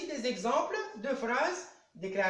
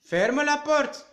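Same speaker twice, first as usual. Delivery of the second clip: loud, slow order, voice higher; command; 5 semitones higher, 9 dB louder, slower than usual. Shown as a demonstration. Teacher giving pupils an example of an imperative, loud and commanding. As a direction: bark it slowly like a firm order. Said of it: "une phrase impérative".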